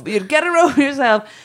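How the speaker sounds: hint of old-fashioned posh Dublin; posh Dublin accent. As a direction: imitate a posh Dublin accent, slightly exaggerated. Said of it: "Posh accent".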